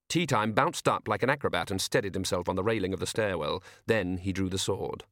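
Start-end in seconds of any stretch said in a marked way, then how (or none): none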